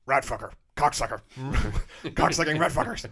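mock Nixon rant. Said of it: "the idea of him being like".

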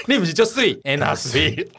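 Anna jumps very high, then slow echo of the pun; high squeaky Anna, then a slow repeat; teasing echo. Retold or imitated. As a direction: echo the pun slowly, poking fun. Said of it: "你不是我水，Anna水".

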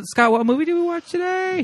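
singsong. Acted. Host question sung high.

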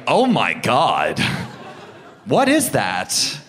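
slow, drawn-out mock horror; appalled disbelief. Comic reacts to hearing a singer speak, appalled disbelief. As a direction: stretch each word in comic shock. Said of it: "And I was like".

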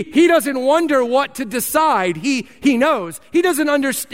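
building preaching. Acted. Pastor insists Jesus knows, building to fervent preaching. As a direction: preach it with growing conviction.

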